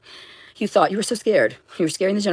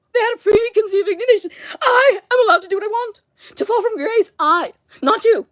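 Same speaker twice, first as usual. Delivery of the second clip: wailing accusation. Real, not acted. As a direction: cry it out at the people who rejected you. Ranting at Dan Sorensen's people.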